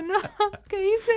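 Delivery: high, hammed-up wail; mock crying. Imitating their own tears after being punished, mock wailing. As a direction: wail it in exaggerated tearful regret. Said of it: "yo sí lloraba así de".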